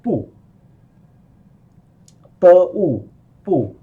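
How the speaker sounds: 不 said with a sharp falling fourth tone; fourth tone demo. Shown as a demonstration. Teacher models 不 for learners, falling fourth tone. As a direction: say 不 with a firm falling tone. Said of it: "不 pronounced as the false tone".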